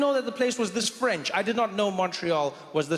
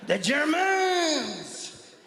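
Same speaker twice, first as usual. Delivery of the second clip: high wailed mock French cry; alarmed French soldier; 7 semitones higher, much slower than usual. Soldier warning sergeant of bombers, mock panic. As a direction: stretch it out in hammed-up dread. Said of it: "the French running into the barracks like".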